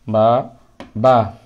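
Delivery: বা said short, no long vowel; short বা drill. Shown as a demonstration. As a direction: say বা crisp and unstretched. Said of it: "টান হবে না".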